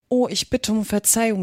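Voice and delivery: usual speaking voice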